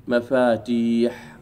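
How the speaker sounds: مفاتيح drawn out slowly, long ي held; slow model plural. Shown as a demonstration. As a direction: dictate مفاتيح slowly for students. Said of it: "مثل إيش؟". (نطق مفاتيح ببطء مع مدّ الياء)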